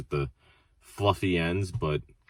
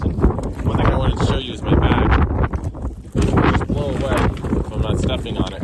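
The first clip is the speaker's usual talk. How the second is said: raised voice outdoors; shouting over wind; 4 semitones higher, 5 dB louder than usual. Real, not acted. Windy beach recording.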